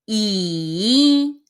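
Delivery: 姨 slow and low, dipping then rising; 姨 in a slow dipping third tone. Shown as a demonstration. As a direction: draw out 姨 as a third tone. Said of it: "the third tone".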